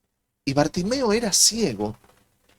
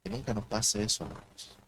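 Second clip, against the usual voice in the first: hushed warning; 6 semitones lower, 12 dB quieter than usual. Real, not acted.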